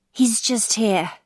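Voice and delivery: put-on slow, slurred and drunk. A narrator's reading. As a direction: drag the words out, drunk. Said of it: "says a slurred voice".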